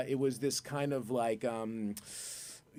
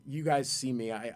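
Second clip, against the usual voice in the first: ordinary talk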